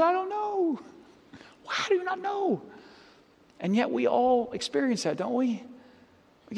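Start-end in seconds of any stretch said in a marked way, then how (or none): 0.0-2.6 squeaky high teary voice; mock wife's whimper. Pastor imitates his wife answering through tears. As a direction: play the wife who can't say why she cries. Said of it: "And she said".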